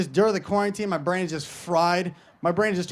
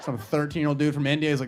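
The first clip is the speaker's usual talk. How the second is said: usual speaking voice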